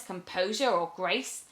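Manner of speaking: ordinary delivery, nothing marked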